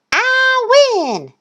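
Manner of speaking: very high, drawn-out crowing; Thistle's triumphant voice. A narrator's reading. Thistle beating Goose, high triumph. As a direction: crow it high and victorious. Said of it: "said Thistle".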